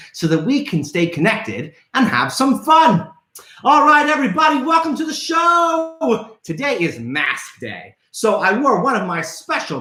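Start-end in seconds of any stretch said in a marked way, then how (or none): 3.6-6.4 showman shout. Real, not acted. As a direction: announce it to the whole room. Host opening shout to kids.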